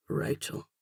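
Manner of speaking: soft, low rumble with a slight brogue; deep-voiced man murmuring her name; deep rumbling man. A narrator's reading. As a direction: rumble the name quietly, rolling it. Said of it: "His deep voice rumbles my name".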